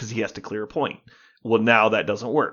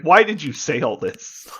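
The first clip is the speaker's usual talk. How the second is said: retold exasperated protest, higher and louder; mock complaint; 5 semitones higher, 5 dB louder than usual. Fans complaining online about the misleading announcement, exasperated. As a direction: imitate baffled online grumbling. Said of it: "everyone complained online".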